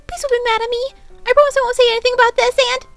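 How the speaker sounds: high, loud, fast begging; panicked pleading. A narrator's reading. Pinkie frantically begs the Cakes, high and fast. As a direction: beg them not to be mad, rushing.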